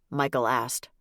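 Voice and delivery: ordinary delivery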